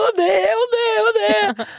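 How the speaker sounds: high, loud mock wail; hammed-up blubbering; mock crying. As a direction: wail it out in an exaggerated tearful retelling. Acts out own crying in retelling.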